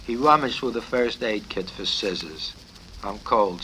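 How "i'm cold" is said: usual speaking voice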